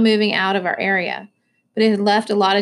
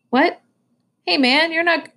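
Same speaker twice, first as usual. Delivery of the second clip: puzzled, higher-pitched reply; mildly taken aback, voice lifted; 8 semitones higher than usual. Acted. Tino answers an apology he doesn't follow, puzzled.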